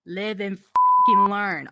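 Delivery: loud emphatic shout. Real, not acted. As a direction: yell it, fed up. Repeating phrase, louder.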